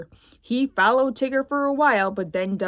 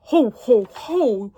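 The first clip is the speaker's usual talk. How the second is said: a louder jolly ho ho ho; jolly Santa laugh; 5 dB louder, slower than usual. Acted. Santa reacting to the story with a louder jolly laugh.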